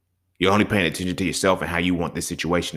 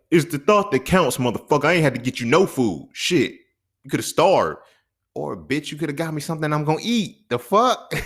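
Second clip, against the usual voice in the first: mock indignant; 7 semitones higher than usual. Acted quarrel over fish sandwich.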